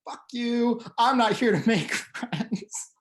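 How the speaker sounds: high-pitched, hammed-up rude rebuff breaking into a laugh; mock hostile attitude. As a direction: play an over-the-top rude snub. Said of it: "that's not the attitude behind it".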